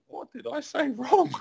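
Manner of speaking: high, drawn-out bewildered question; retold bewilderment, slow and high; baffled protest. Baffled reply to her outburst.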